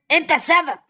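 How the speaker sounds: shouting the accusation, falling to a close; final angry blame. Acted. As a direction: slam the blame down hard. Blaming the dog loudly.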